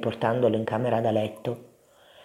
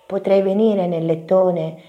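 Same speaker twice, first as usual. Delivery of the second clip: high, pleading little mouse; small voice asking a favour; Topino; 5 semitones higher, 3 dB louder than usual. A narrator's reading. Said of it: "chiese Topino". (vocina acuta che chiede)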